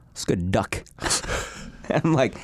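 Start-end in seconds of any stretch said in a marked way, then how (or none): 0.1-0.8 low, soft near-whisper; deadpan approval. Retold or imitated. Retelling friend tasting mom's chicken, hushed deadpan murmur. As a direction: imitate an unbothered eater murmuring. Friend chewing, insists it is duck.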